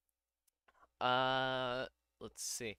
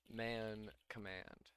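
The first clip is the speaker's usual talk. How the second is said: slow; 3 semitones lower, 9 dB quieter, much slower than usual. Real, not acted. Reading the command out slowly.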